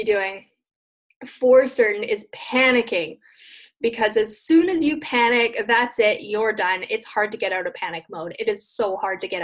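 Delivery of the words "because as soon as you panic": ordinary talk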